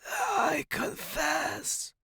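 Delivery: slow, high, strained rasp; dying croak. A narrator's reading. Professor glaring at student, strained croak. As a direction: wrench it out with last strength. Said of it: "He glared at me and said".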